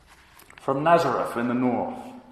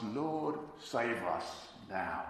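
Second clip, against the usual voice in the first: hushed, slow plea for rescue; soft plea; 10 dB quieter, much slower than usual. Retold or imitated. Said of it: "It's a cry to be saved".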